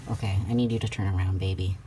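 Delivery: ordinary talk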